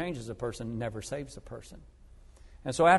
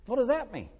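puzzled question; 9 semitones higher than usual. Retold or imitated. Seatmate baffled by forsake it.

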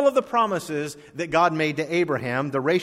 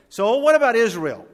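loud pulpit question; 8 semitones higher, 6 dB louder than usual. Acted. Preacher poses the key question.

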